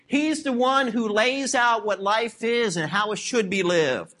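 ordinary talk

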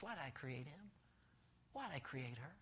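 a hushed, regretful sigh of a question; God asking, soft and grieved, with a head shake; sorrowful God. Acted. Grieving God shaking his head, very soft and regretful. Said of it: "he just shakes his head and goes".

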